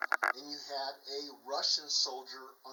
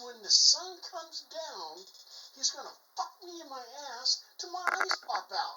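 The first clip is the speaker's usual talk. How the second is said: acted alarm, voice pitched way up; freaking out; 9 semitones higher, 3 dB louder than usual. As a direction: wail the dire threat as a rattled soldier. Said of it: "the Russian soldier is freaking out".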